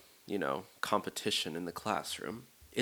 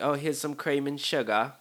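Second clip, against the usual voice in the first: higher, badly faked British voice; horrible British accent; 5 semitones higher than usual. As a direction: imitate a British friend offering politely. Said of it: "sorry for this horrible accent".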